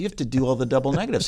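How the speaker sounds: usual speaking voice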